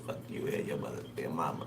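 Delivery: hushed, breathy whisper of a spirit's curse; low whispered swearing. Retold or imitated. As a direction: pass on the insult in a low whisper. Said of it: "He said".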